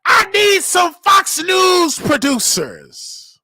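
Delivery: slow, high shout to the heavens; preacher-style shouted appeal; shouted plea. Real, not acted. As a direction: call it out big and drawn out. Shouted plea opening the show.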